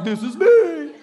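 high, loud, laughing voice of Jesus. Retold or imitated. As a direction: play Jesus revealing himself. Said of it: "Jesus is like".

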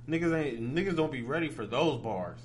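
ordinary delivery

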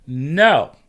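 mock kid's loud, high refusal; defiant child's no. Child's defiant refusal, retold escalation.

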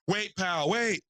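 half-hammed yell to wait; mock call out. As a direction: call him back across the lot. Clerk chasing him outside.

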